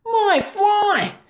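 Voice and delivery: high, shocked gasp. A narrator's reading. Boy seeing his fly wreck the kitchen, gasping. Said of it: "gasped Harvey".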